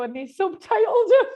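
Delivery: high, loud indignant punchline; amused outrage. Real, not acted. Presenter revealing the show subtitled their accents, amused outrage. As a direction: blurt the insult out, loud and high.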